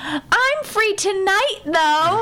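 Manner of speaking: put-on high, slow old lady call; imitated old lady in the audience. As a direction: pipe up hopefully as an old lady. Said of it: "some old lady stands up".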